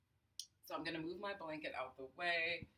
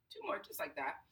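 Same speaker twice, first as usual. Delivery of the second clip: guided breathing. Acted. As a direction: count the breaths, unhurried and soothing. Leads a breathing exercise.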